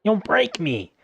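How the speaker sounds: high squeaky little creature voice; shrill and squeaky. Acted. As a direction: squeak it out like a tiny pest. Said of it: "in a shrill and squeaky voice".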